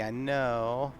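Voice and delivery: ordinary talk, nothing marked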